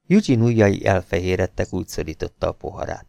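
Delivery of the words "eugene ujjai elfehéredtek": plain reading voice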